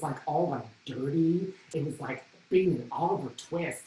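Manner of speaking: usual speaking voice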